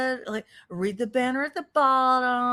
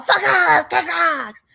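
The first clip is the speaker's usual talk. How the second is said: parody shout; 3 semitones higher, slower than usual. Pretend. Mocking the rant, alternating calm and yelling. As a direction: yell it like a peaceful hypocrite. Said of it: "So what? I want peace".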